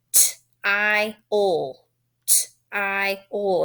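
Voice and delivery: T-I-L as t, long "eye", ul, with gaps; three separate sounds. Shown as a demonstration. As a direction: sound out T-I-L one sound at a time. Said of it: "It's only three sounds".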